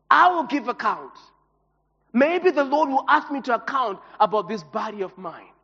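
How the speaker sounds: usual speaking voice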